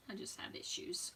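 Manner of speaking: soft whispered self-mockery; whispered aside. Real, not acted. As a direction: drop to a whisper, poking fun at yourself. Self-deprecating aside while crafting.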